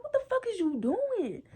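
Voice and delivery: high, indignant demand; confronting. Retold or imitated. To boyfriend at the park, an indignant high demand. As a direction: call him out, pitch up.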